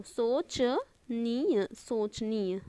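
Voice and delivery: "सोचनीय" slowly as soch, pause, niya; broken into parts. Shown as a demonstration. Teacher dictating to students, slow and segmented. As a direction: stretch out "सोचनीय" in two pieces. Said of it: "मतलब जो सोचने योग्य हो".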